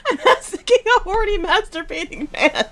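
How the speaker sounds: fast, loud words through laughter; barely getting it out while laughing; laughing hard. Real, not acted. Laughing at absurd situation.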